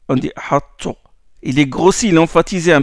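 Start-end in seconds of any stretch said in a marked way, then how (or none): 0.1-0.9 ahattu with the t kept, slightly emphatic; correct ahattu. Shown as a demonstration. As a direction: say ahattu keeping the t's traits.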